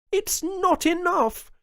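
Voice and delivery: very high, slow, loud protest; anguished governor. A narrator's reading. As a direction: cry out the word not. Jones answers Martinez.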